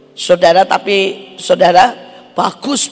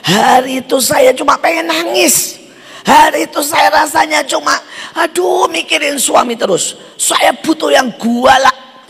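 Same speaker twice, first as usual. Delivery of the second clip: impassioned plea; 8 semitones higher than usual. Retold or imitated. Woman's plea retold in sermon.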